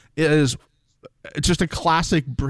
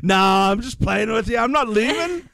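put-on voice climbing higher and higher; Chloe; 7 semitones higher than usual. Host retelling contestant's fake walkout, rising playful impersonation. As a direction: imitate a teasing contestant, pitch rising as you go. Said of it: "And she goes".

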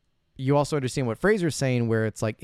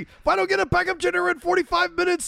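very high, loud hammed-up rant; mock frantic yelling; 5 dB louder than usual. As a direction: shriek it like an over-the-top movie meltdown. Acted movie scene, frantic yelling.

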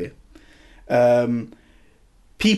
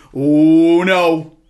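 imitating a heckler, louder and climbing higher; mock shout at screen; 4 semitones higher, 4 dB louder, much slower than usual. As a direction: plead loudly at the screen, rising. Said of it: "shouting at the screen".

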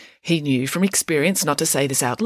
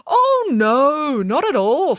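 high scoffing no; dismissive; 10 semitones higher, 4 dB louder, much slower than usual. Acted. Nanny Piggins scoffing at Samantha, high and dismissive. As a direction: wave the idea away.

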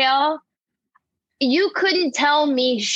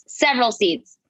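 normal talking voice

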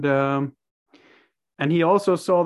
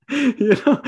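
laughing; 9 semitones higher, 4 dB louder than usual. Real, not acted. Laughing inside the sheriff quote.

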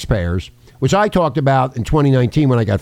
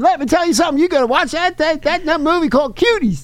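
high, strained put-on politician voice; Bill Clinton. Host joking as a famous man recommending a movie, high put-on impression. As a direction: do a high-pitched mock impression, gleefully seedy. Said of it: "I'm Bill Clinton".

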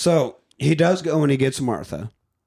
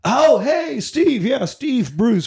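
high, over-eager hello; mock-cheery awkward introductions; flustered greeting; 10 semitones higher, 3 dB louder, slower than usual. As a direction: make hurried introductions in a raised, excited pitch.

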